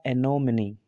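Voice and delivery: "एनोमनी" as e-no-mi-ni; correct pronunciation. Shown as a demonstration. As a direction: sound the final e as ee in "एनोमनी". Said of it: "इसका जो pronunciation है". ("एनोमनी" के अंत में ई की आवाज़)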